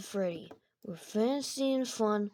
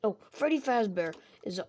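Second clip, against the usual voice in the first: usual speaking voice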